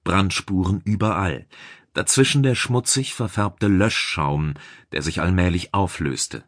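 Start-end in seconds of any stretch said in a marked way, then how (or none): none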